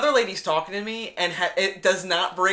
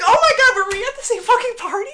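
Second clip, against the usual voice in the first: loud, very high disbelief; exaggerated shrieky exasperation; retold incredulity; 10 dB louder, faster than usual. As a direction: fire it back fast and aghast. Retold disbelieving reply to mother.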